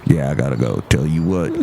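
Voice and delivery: very low put-on character voice; visiting comic character. Visitor greeting the host, very low character voice. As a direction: drop right down for the odd visitor. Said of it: "There he goes".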